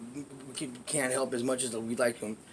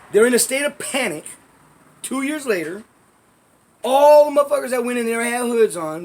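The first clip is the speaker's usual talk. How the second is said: raised, high, loud incredulous voice; indignant disbelief; 9 semitones higher, 8 dB louder than usual. Real, not acted. Doubting that anyone could identify hooded shooters, loud and incredulous. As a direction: challenge the absurd claim loudly.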